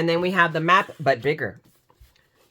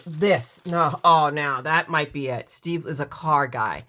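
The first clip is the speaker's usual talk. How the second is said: usual speaking voice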